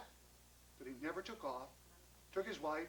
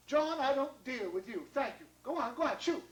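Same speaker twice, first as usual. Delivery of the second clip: higher, emphatic brush-off; haughty dismissal; 5 semitones higher, 3 dB louder than usual. Acted. Missus Irons refuses John's raspberries, dismissive.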